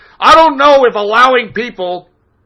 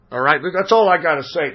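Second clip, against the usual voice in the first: ordinary delivery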